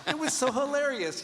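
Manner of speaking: high, delighted, overjoyed gush. Retold or imitated. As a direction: rave about the mistake with glee. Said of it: "he's like".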